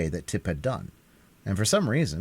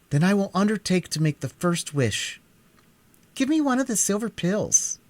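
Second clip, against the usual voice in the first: much higher, lightly voiced boy; a bold boy volunteering; higher, earnest young Tip; 12 semitones higher than usual. A narrator's reading. Tip offers to go first.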